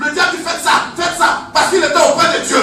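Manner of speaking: loud, driving preacher's cadence with laughs; preaching at full force. Acted. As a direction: hammer the point home to the congregation. Sermon rising against the devil.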